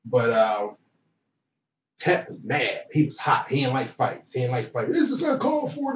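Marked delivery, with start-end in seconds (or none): 4.9-5.9 a hint of an indignant coach, higher; imitating the coach. Coach scolding players after a fight, lightly mocking higher voice. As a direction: voice the coach's prim protest, pitched up. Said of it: "You got all these voices".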